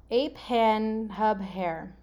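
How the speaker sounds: very slow, separate words; deliberate word-by-word puzzle clue; gibberish readout. Shown as a demonstration. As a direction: space out ape, hand, hub, hair evenly. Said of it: "read you what it says".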